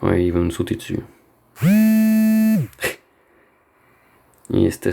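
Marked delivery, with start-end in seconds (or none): 1.6-2.9 startled squealing laugh; shocked high laugh. Real, not acted. Jumpscare laugh. (rire aigu de surprise)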